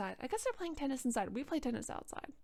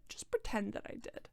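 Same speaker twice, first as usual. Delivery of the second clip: repeated softer and breathier; soft aside; 6 dB quieter than usual. Shown as a demonstration. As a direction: drop it to a hushed aside. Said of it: "But just pretend that I did".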